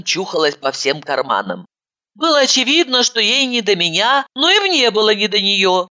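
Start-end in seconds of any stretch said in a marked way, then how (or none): none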